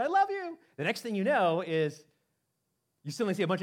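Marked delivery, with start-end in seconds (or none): 0.0-0.6 high, loud I love you; a last cry to her husband; wife to her husband as the tornado strikes, desperate; retold desperate goodbye. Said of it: "she says to her husband".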